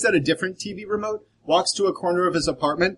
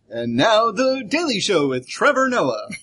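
higher, slow, drawn-out announcer intro; show announcer; 5 semitones higher, slower than usual. Acted. Player cutting in with a TV show intro, announcer voice. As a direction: announce it grandly, stretching the words.

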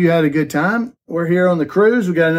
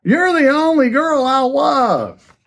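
high, drawn-out mock yell; hammed-up high-pitched declaration of love; loud declaration; 9 semitones higher, slower than usual. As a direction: call it out slowly over loud dance music. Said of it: "he loudly tells her".

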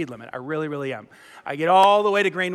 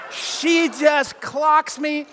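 high, very slow and drawn out; dramatic emphasis; 6 semitones higher, much slower than usual. Retold or imitated. Storyteller revealing the punch, slow and dramatic. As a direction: stretch every word for the punchline.

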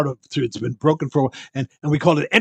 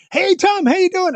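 fairgoer calling out; 4 dB louder than usual. Retold or imitated.

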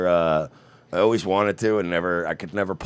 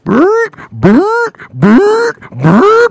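imitated alarm ring; 9 dB louder, much slower than usual. Alarm noise before bank panic.

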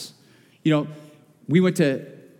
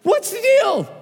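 high, louder exasperated shout; exasperated outburst; 12 semitones higher, 4 dB louder than usual. Retold or imitated. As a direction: let the frustration burst out at the officer. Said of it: "the unspiritual side of your pastor came out".

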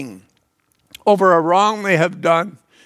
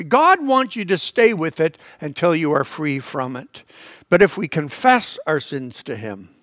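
ordinary delivery

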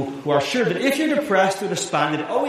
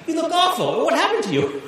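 mock surprised; 12 semitones higher than usual. God bursting into the cave, mock surprised. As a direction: play God as comically caught off guard. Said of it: "surprised, exclaims".

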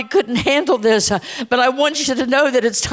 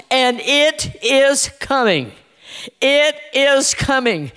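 slow, weighty, emphatic; slow warning; 2 semitones higher, slower than usual. Real, not acted. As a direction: let each word land like a warning. Slow ominous warning, repeated.

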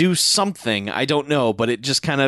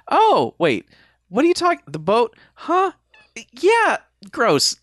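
high squeaky confused ramble, much higher; baffled and grossed out, squeaking up; mock confusion; 11 semitones higher, 4 dB louder, slower than usual. Retelling the heroine's confused reaction, high and baffled.

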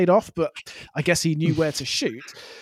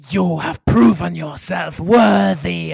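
slow, loud regal proclamation; mock-imperious declaration; imitated Empress; 5 dB louder, slower than usual. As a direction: pronounce judgement like a grand empress, drawing out each word. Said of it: "And she's like".

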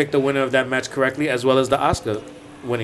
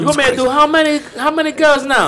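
high, put-on Jamaican accent; a hammed-up Jamaican braider's complaint; teasing the hosts, a hammed-up Jamaican accent; mock Jamaican accent; 12 semitones higher than usual. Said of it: "That accent".

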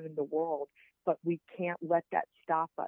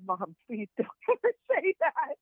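high-pitched protest breaking into laughter; laughing plea; 9 semitones higher, 3 dB louder than usual. Retold or imitated. Daughter to mom at the hospital bedside, laughing plea. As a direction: plead through a laugh, voice jumping up.

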